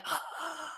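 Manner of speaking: exaggerated shocked gasp; sharp, acted intake of breath in offence; mock gasp. Acting out an offended reaction.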